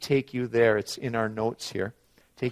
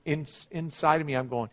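ordinary delivery

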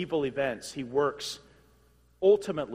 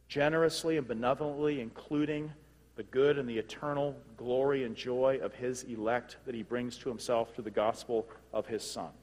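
normal talking voice

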